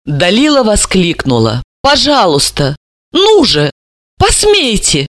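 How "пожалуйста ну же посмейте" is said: rising higher to a desperate dare; ringing, desperate. Acted. Dalila daring Anton to ask her, ringing and desperate. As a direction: dare him, voice climbing. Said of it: "слишком звонко и громко, как отчаяние".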